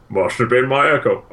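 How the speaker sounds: mock drunk guard, lightly voiced; drunk guard echo line. Retelling the drunk guard's line to a friend, lightly slurred. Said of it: "Which cracks me up every time".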